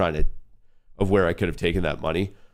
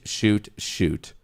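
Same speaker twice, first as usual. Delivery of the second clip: ordinary delivery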